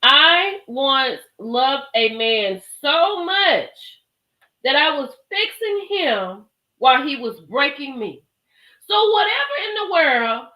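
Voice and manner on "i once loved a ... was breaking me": slow, louder call-and-response read; slow recital. Shown as a demonstration. Leading viewers to recite the quote together, slow and loud. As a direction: lead the crowd through it slowly. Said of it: "I want you to say it with me".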